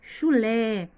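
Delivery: chulé said loud with a long, strong LÉ; loud chulé, landing hard on LÉ; stress on LÉ. Shown as a demonstration. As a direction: punch the final syllable of chulé. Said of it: "Tem acento no lé".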